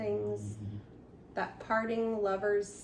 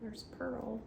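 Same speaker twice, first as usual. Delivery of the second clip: hushed aside; 8 dB quieter than usual. Real, not acted. Quiet aside while reading.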